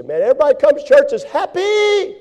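hammed-up outsider's complaint; mock exclaim. Preacher mimicking a skeptic about happy churchgoers, loud high.

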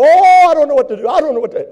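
hammed-up panic over everything; frantic panic. Preacher mocking a frantic churchgoer, loud high panic. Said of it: "you're frantically panicking".

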